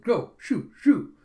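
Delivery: high, loud mock shooing; shooing away. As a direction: shoo off a stray dog. Vendor shooing stray dog away.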